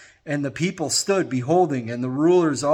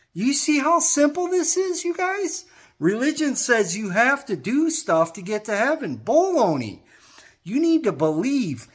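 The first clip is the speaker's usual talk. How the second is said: high, urgent preaching voice; pleading street sermon, pitch raised throughout; fiery preaching; 7 semitones higher than usual. Acted. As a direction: preach it out to the crowd with conviction. Street preacher at full force.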